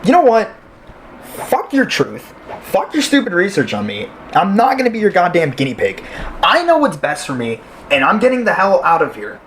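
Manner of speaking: defiant, heated refusal; angry defiance. Acted. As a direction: reject them outright, starting with a high burst. Patient storms out after argument.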